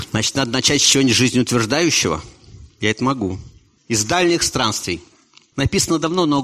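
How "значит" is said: ordinary talk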